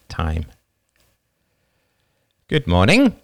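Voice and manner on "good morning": a brisk, lightly sarcastic morning greeting, higher; wife's higher voice with a touch of sarcasm. Acted. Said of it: "her usual slight note of brisk sarcasm".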